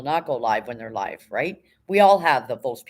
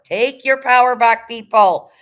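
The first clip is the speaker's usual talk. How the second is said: loud, slow, higher call to the viewers; slow rousing yell for the audience; rallying shout; 7 semitones higher, 9 dB louder, slower than usual. Real, not acted. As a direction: shout it out like a battle cry.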